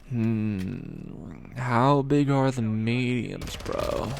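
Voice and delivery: slow, spaced-out stoner voice; lightly voiced burnout, drawn out slowly; stoned drawl. Stoned caller pondering pizza sizes, slow spaced-out drawl.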